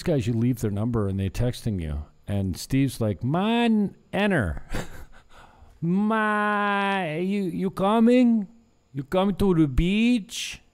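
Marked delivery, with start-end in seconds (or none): 3.2-4.6 high imitation of Steve's accented "Man, enter."; imitating friend's accent. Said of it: "I don't do the accents well". 5.8-10.6 high, slow imitation of Steve's accent; imitating friend's accent. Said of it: "I don't do the accents well".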